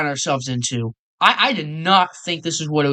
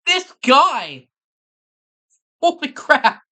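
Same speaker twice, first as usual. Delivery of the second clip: shocked outburst; 8 semitones higher, slower than usual. Real, not acted. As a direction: blurt it out in shock. Reacting loudly to the story.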